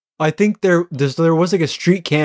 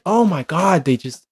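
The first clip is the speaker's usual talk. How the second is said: ordinary talk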